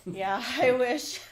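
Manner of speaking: real amused burst of laughter; laughing. Real, not acted. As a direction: laugh through the words, loudly. Guest laughing at teasing guess.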